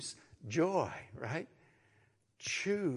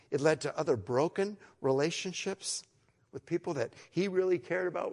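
ordinary talk, nothing marked

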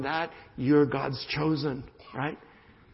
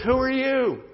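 higher, sharp challenge; challenging retort; 9 semitones higher, 4 dB louder than usual. Acted. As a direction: throw the question back at him. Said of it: "and the guy says".

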